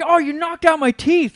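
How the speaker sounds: put-on a kid blurting out that his teeth are gone; retold alarmed kid. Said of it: "I'm like".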